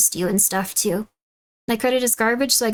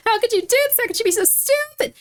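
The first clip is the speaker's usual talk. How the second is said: mock yelling host; 12 semitones higher than usual. Imitating the finance host yelling at a guest. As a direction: scream it like a parent losing it. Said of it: "This is exactly how he talks".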